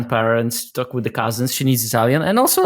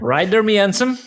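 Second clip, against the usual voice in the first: a hint of a Cornish local, pitched higher; lightly mocked Cornish greeting, bright and raised; Cornish accent; 7 semitones higher than usual. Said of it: "like a pirate from bloody Pirates".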